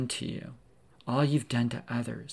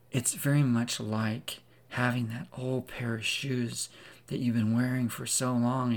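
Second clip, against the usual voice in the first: usual speaking voice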